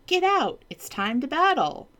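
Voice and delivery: high, loud battle call; commanding knight. Acted. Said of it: "cried the knight".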